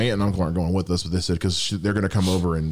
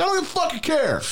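high-pitched exasperated outburst; mock angry retort. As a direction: act out fed-up defiance at the cat. Acts out retort to cat.

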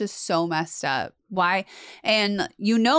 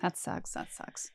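whispered "that sucks"; real hushed whisper of dismay; 16 dB quieter than usual. Real, not acted. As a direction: breathe it out under your breath. Listener dismayed by the story.

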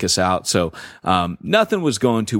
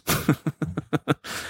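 short laugh at himself; amused laugh; 7 semitones higher, 6 dB quieter than usual. Real, not acted. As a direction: laugh it off lightly. Laughs at own quote.

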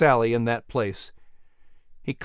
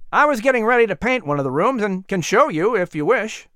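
put-on higher, eager young man's voice; a helpful workman greeting, pitched up; young worker; 6 semitones higher than usual.

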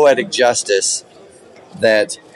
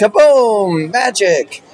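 high, punchy magician's exclamation; hammed-up stage reveal, pitched high; mock showman flourish; 10 semitones higher, much slower than usual. Magician parodying the right way to end a trick, hammed-up flourish.

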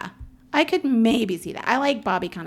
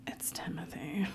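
whispered teasing correction; whispered aside teasing the name; 15 dB quieter than usual. Real, not acted. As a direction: hush the correction as a joke. Mock correction of pronunciation.

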